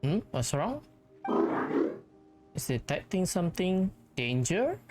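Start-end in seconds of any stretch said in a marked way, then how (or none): none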